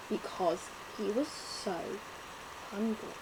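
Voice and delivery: plain reading voice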